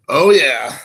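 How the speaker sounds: usual speaking voice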